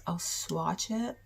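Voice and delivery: ordinary talk, nothing marked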